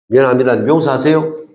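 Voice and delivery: usual speaking voice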